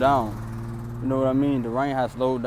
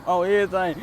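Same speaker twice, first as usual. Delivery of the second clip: ordinary delivery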